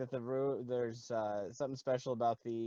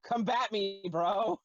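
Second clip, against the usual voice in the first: higher, louder taunt to the enemy; taunting challenge; 8 semitones higher, 6 dB louder, slower than usual. Acted. Player's character daring wraiths after casting fire, loud taunt. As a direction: call them out, pitched up and loud. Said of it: "and then I go".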